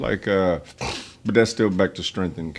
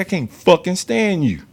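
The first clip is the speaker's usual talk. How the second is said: slow, pointed, higher and louder; retold angry face-off; 8 semitones higher, 6 dB louder, slower than usual. Telling off the venomous sergeant face to face, slow and pointed. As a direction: plant each word right in his face. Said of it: "I said".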